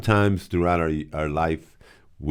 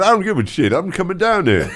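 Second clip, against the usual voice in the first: high, louder mock angry boss; angry retort; 10 semitones higher, 4 dB louder than usual. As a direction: snap it back in mock fury.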